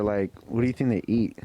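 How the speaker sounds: ordinary talk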